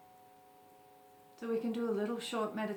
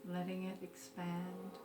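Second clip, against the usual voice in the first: guided meditation; 7 dB quieter than usual. Acted. Teacher leading listeners through a short breathing meditation, calm guided meditation. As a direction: gently guide them into stillness, unhurried.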